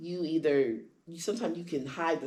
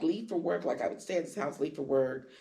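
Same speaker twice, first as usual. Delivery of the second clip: ordinary delivery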